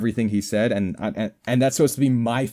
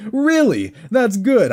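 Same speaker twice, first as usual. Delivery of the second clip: incredulous really; 9 semitones higher, slower than usual. Pretend. Mocking the NPC hint: incredulous high Really?. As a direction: repeat Really? much higher, astonished. Mock disbelief rising.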